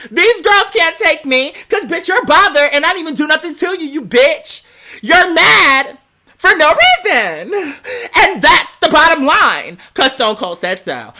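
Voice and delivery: usual speaking voice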